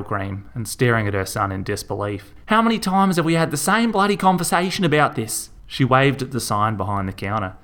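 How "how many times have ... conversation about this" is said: high, loud scolding mum; exasperated scolding. A narrator's reading. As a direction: berate him for the hundredth time. Mother scolding her son.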